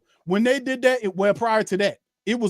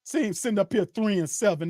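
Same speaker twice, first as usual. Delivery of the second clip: ordinary talk, nothing marked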